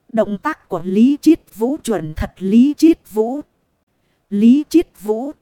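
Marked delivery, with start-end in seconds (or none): 2.4-5.3 calling out name. Acted. Fans calling his name.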